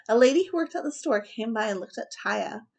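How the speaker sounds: ordinary delivery, nothing marked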